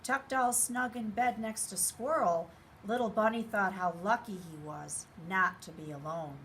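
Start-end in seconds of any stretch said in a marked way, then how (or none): none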